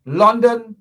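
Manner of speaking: London shouted out; loud burst. Real, not acted. Telling the audience where to protest, with a loud burst. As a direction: belt the last word of the list.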